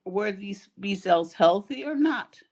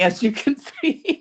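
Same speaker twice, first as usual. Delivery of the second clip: laughing; 5 semitones higher, 6 dB louder than usual. Real, not acted. Joking about her own investigating.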